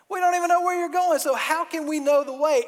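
Thomas protesting. Acted. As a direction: blurt out the objection in a rush.

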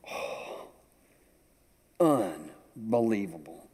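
breathy hushed oh, slow unbelievable; savouring. Real, not acted. Grandfather praising banana bread, hushed and slow. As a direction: relish the memory of the taste.

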